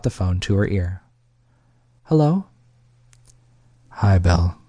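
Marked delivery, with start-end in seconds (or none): 2.0-2.5 higher, louder tentative hello; Belle answering. Acted. 3.9-4.6 lower, louder male voice; mystery man on phone. Acted. A man said her name.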